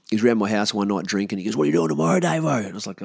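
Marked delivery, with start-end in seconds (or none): 1.6-2.6 higher-pitched, mildly hyped mate; lightly acted eager question; keen mate. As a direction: imitate an eager drinking buddy. Drunk mate keenly asking him.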